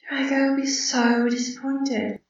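high, slow and soft; drawn-out, gentle inner voice; inner thought. A narrator's reading. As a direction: think it aloud, softly and high. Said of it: "she thought".

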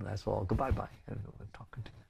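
fading to a near-murmur; soft, fading mock farewell; drifting away. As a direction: trail off softly as if floating away. Acts out drifting away into love.

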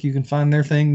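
ordinary delivery

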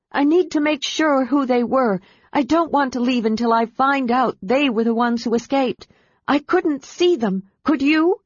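Kitiara yells at her dragon over the battle; yelling. A narrator's reading. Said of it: "she yelled at Sky".

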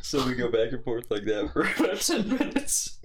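real laughter; laughing. Real, not acted. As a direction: laughs through the words. Laughing at his pacifist line.

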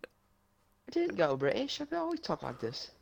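high, soft British denial; put-on British accent. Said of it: "did you just go British".

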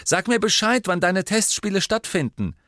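high, loud, eager call; calling over. A narrator's reading. Teammate asks the captain about trials, calling over. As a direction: pitch it up and call across a room. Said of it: "rief sie herüber".

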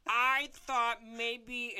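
high, loud and very slow cry of relief; relieved scream. Acted. Dan hearing it is only a weak heart, slow loud cry of relief. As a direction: shout the relief out, stretching every word. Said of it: "Screams and is like".

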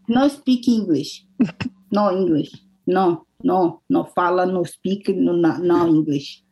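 slow, halting broken English, word by word; broken English plea. Retold or imitated. Tourist pleading slowly with an airport couple, broken English. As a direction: spell it out slowly like to a stranger. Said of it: "Aí eu".

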